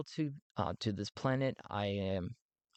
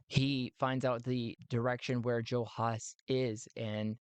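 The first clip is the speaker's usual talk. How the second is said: ordinary talk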